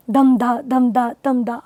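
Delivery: dum da, dum da in even paired beats; heartbeat rhythm. Shown as a demonstration. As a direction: beat out DUM DA like a steady pulse. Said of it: "it's just like heartbeat".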